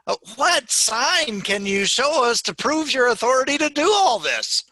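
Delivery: loud, high-pitched demand; indignant challenge. Acted. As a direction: confront him in acted outrage. Challengers confront Jesus in story.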